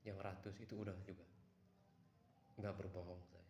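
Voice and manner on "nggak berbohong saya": ordinary talk, nothing marked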